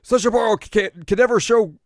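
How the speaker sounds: very high, loud mock wail; Jokin crying. Little demon crying on his master's behalf, mock wailing. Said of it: "then Jokin starts crying".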